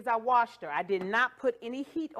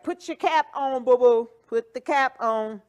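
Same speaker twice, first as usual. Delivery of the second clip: mock insistent order, louder; imitated bossy demand; 9 dB louder than usual. Host voicing viewers ordering the cap on, mock loud demand. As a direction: call it out like demanding fans. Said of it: "they be like".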